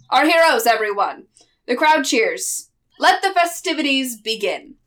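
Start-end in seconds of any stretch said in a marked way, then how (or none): 0.1-1.3 booming town mayor proclaiming; jolly mayor. Acted. Mayor cheers. 3.0-4.8 booming town mayor proclaiming; jolly mayor. Acted. Mayor announces.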